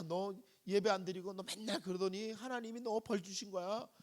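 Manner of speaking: quoting a deacon furious at church gossips; indignant rant. Retold or imitated. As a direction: vent like a wronged churchgoer. Hurt deacon rants about visitors.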